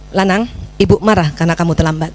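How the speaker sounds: low, restrained scolding; controlled reprimand. Shown as a demonstration. Mother telling son his lateness angers her, controlled reprimand. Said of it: "itu yang keliru".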